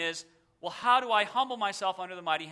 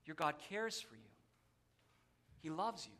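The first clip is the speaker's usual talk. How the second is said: soft, lower, unhurried assurance; tender reassurance; 3 semitones lower, 13 dB quieter than usual. Real, not acted. Preacher telling congregation God loves them, tender reassurance. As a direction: let it sink in gently, hushed and low.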